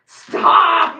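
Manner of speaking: loud, high shout at the cats; 1 shout stop. Real, not acted. Streamer shouting her cats off the treat piles. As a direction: shout it to chase them off.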